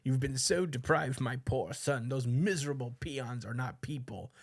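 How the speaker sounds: a hint of a grand, indulgent dad; lightly voiced father. Dad urging his poor son to forget the peons, lightly voiced father. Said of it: "with his dad being like".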